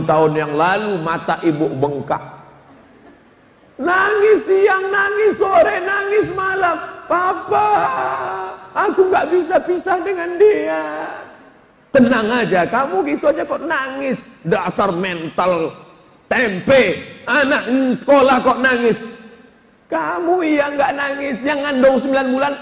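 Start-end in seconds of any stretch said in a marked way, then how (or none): 3.8-10.8 high, crying wail, drawn out at first; mock wailing. Mother weeping to her husband about parting from child, high mock wail. 11.9-19.0 mocking husband. Husband mocks crying wife.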